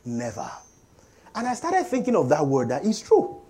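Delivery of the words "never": lower, softer, slow retold admonition; wife's gentle correction. Wife cautioning the preacher, retold soft correction. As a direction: quietly draw out the correction. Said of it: "my wife was like".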